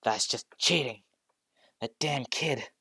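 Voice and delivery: a sore loser snapping; annoyed villain. Acted. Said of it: "getting a bit annoyed".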